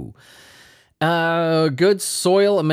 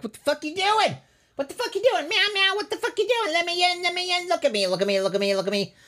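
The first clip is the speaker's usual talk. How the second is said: squeaky cat voice, far higher; 5 dB quieter, faster than usual. Retold or imitated. Cat whining at owner through the door, high and frantic. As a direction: whine like a cat demanding to come in. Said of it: "his best Meryl Streep fucking impression".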